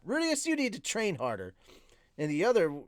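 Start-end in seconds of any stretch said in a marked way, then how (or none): 0.0-1.5 high-pitched scolding, temper lightly acted; Eris, snapping. A narrator's reading. Swordswoman losing her temper with friend, snapping. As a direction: snap it as a raised-pitch order. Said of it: "lose her temper and snap".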